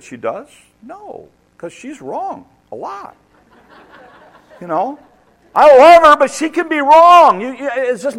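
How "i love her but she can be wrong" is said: loud. Real, not acted. Joking about his wife.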